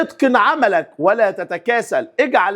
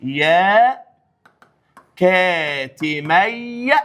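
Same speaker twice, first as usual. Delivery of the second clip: drawn-out word; much slower than usual. Shown as a demonstration. Teacher slowly stretches the corrected word for students. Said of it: "فهقول إيه؟".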